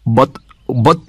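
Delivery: mildly angry stammered protest; angrily. A narrator's reading. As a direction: start to object, a touch angry. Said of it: "began Goldfinger angrily".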